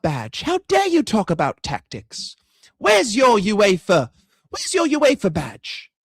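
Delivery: hammed-up British accent, slow and affronted; mock British accent. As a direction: scold like an outraged British supporter. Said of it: "That's what they fucking sound like".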